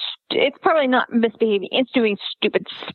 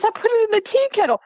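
put-on proud kid; 10 semitones higher, faster than usual. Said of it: "He goes".

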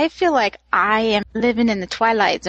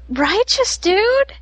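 high, stretched-out surfer dude cheer; 9 semitones higher, slower than usual. Retold or imitated. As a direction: channel a Ninja Turtle saying righteous. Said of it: "watching a little too much Teenage Mutant Ninja Turtles".

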